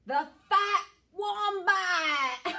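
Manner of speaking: slow and loud with a villain laugh; sly dingo. Acted. Dingo gloating about the fat wombat, ending in a villain laugh. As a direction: gloat then cackle. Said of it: "says the clever dingo".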